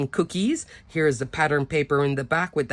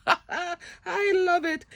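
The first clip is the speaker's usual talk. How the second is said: laughing delight; 12 semitones higher, much slower than usual. Real, not acted. Laughing over a cute card.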